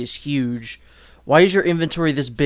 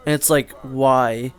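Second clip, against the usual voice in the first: usual speaking voice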